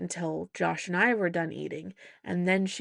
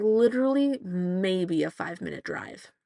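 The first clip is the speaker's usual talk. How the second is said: ordinary talk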